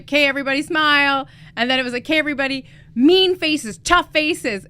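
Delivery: imitating a photographer's upbeat instructions; retold photographer's call. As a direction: call out poses to a crowd, lightly. Retelling photographer calling group poses.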